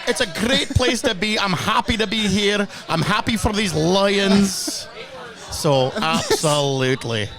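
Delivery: put-on Scottish accent, rolled R's. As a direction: stay in the Glasgow fan character. Holds put-on Scottish fan voice.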